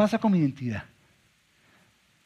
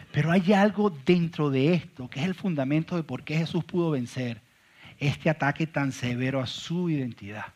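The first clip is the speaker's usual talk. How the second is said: usual speaking voice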